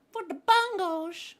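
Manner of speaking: "Bungles" in a very high, slow falsetto squeak; sing-song teasing of "Bungles" way up high; mock squeaky taunt.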